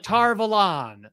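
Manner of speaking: Tar Valon drawn out slowly to "Tar Va-LAHN"; show's pronunciation. Retold or imitated. Quoting how the show says it, mocking drawn-out pronunciation. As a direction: stretch "Valon", long open last syllable. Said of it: "and they're like".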